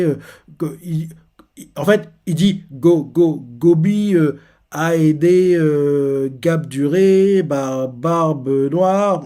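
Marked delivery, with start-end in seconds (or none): 2.7-9.2 slow, garbled, louder sobbed words; blubbering. Retold or imitated. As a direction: mangle each name through tears. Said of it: "En gros, il veut dire". (bafouillé en pleurant, très lent)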